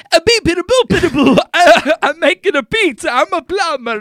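loud, high-pitched cartoon Italian accent; over-the-top Italian accent. Shown as a demonstration. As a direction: ham up a wildly exaggerated Italian, half laughing. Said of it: "That's hyperbolic. That's over the top".

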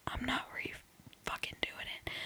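whispered aside; conspiratorial whisper to the listeners. Acted. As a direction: breathe it out, barely voiced.

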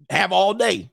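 normal talking voice

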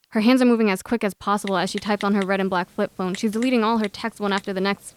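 ordinary delivery, nothing marked

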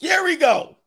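high mock shout; hammed-up high-pitched cry; excited call. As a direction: whoop it as the action kicks off. Acted grab, calling out.

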